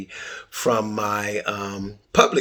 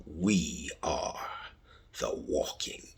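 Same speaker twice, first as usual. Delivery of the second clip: softer, whispered title reveal; dramatic whisper; 6 semitones lower, 10 dB quieter than usual. Pretend. Host unveiling the podcast title, dramatic whisper. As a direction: hush it like a secret unveiling.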